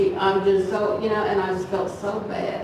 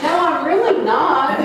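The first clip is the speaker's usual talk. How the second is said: higher, protesting it's not so; mock-indignant protest to God; indignant denial; 7 semitones higher, 4 dB louder than usual. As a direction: insist you're innocent, a bit pitched up. Arguing with God.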